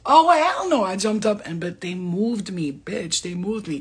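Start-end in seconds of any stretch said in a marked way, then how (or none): 0.1-0.8 high, loud mock-startled cry; startled protest. Jumping up as they move her, mock-startled. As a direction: reenact jumping up in loud refusal. Acts own startled reaction, retold.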